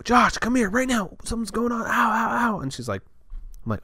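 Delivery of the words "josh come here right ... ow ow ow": high, panicked cry of pain; panicked call for help. Retold or imitated. Girlfriend with a burning eye calls Josh, high panicked cry. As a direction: imitate her calling out in pain from the other room. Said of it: "I just hear her go".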